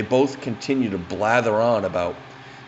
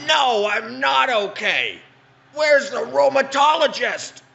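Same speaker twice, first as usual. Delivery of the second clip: put-on high, drawn-out quavery old woman, slow; retold old lady in pain, high and slow; 13 semitones higher, slower than usual. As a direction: play the confused fallen granny, pitched way up. Old lady retold, fallen at door.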